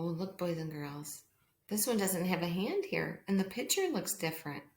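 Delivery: usual speaking voice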